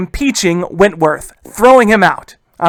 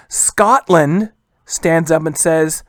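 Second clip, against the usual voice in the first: usual speaking voice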